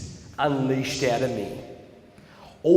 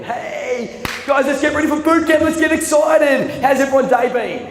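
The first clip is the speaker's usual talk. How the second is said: much higher, loud, shouted bubbly coach; happy Aaron; 12 semitones higher, 9 dB louder than usual. Retold or imitated. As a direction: fire up the bootcamp class. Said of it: "happy Aaron again".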